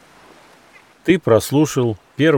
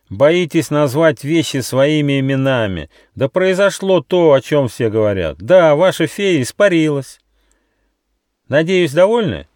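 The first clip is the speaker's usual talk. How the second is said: loud, high, exasperated tirade; angry shout; 7 semitones higher, 5 dB louder than usual. A narrator's reading. Squirrel tells the natives the fairy vanished, angry. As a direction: blurt out the truth loudly and angrily. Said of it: "сердито закричал Рыж".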